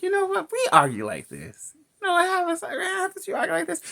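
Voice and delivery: high squeaky mock voice of the friends. Friends warning the couple, in a high mocking voice. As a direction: squeak it out like know-it-all friends. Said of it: "everyone's like".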